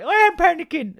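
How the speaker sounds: a jump up in pitch and volume, half-joking; mock panic. Real, not acted. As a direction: play up the panic, high and loud. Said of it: "why am I panicking".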